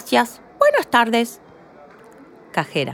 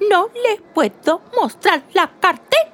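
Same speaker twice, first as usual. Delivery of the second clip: higher and forceful, leaning hard on cartera; the lady firmly refuses, pushing the final words out; said with great force; 7 semitones higher than usual. Acted. The shopper refuses the cashier, forceful. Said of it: "Pronuncia las últimas palabras con mucha fuerza".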